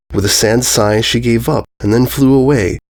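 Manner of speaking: plain reading voice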